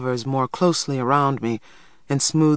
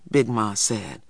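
usual speaking voice

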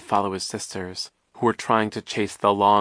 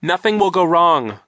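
high, loud reassurance; raised, straining insistence; insistent promise; 9 semitones higher, 9 dB louder than usual. A narrator's reading. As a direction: promise it urgently over the struggle. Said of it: "Klaus promised".

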